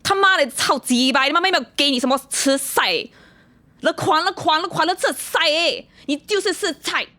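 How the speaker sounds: furious shouted rant in Chinese; angry Chinese tirade. Pretend. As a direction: curse them out loud in Chinese. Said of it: "give us a short demonstration".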